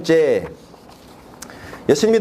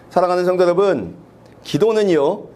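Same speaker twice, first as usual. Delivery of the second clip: usual speaking voice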